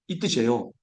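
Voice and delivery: ordinary talk, nothing marked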